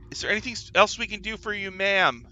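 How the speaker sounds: eager guard. Acted.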